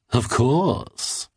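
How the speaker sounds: lightly voiced odd man, rising confidently; a hint of a smug stranger; smug reply. A narrator's reading. Odd man answering the cartoonist about his tonic, smug.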